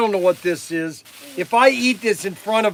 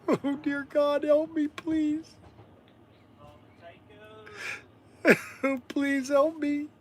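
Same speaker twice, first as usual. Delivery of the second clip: mock plea; 8 semitones higher, 7 dB quieter, slower than usual. Mock pleading before eating mush.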